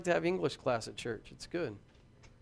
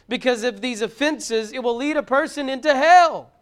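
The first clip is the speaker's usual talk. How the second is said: loud, high fiery sermon voice, climbing; preaching at full force; 5 semitones higher, 8 dB louder than usual. Acted. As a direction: thunder the warning at the congregation.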